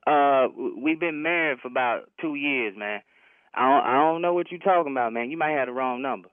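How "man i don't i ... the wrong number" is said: ordinary delivery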